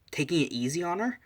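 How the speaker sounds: incredulous question, pitch climbing high; puzzled question. Retold or imitated. The audience wondering about the fight, incredulous. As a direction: ask it in rising disbelief.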